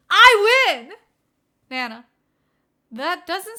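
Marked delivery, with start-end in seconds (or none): 0.1-0.9 loud, high victory cry; gleeful little brother winning; triumphant gloat. Acted. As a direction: crow over your win. Ghost gloats over the prank.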